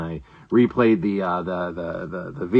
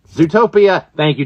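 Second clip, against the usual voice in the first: ordinary talk, nothing marked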